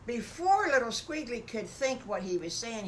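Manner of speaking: usual speaking voice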